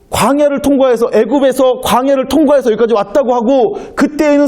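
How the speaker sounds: acted, high-pitched remonstrance; protesting. Gideon challenging God's messenger over missing miracles, protesting. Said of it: "하고 항변한 거예요".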